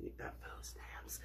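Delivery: slow whisper; whispered aside. Acted. Asking cousins for help in a sly whisper. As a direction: hush it like a secret.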